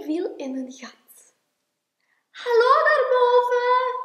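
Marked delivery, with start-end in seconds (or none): 2.3-4.0 high, loud, sung-out call. A narrator's reading. Klein fallen in a hole, calling up. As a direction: holler up from below. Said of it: "riep hij".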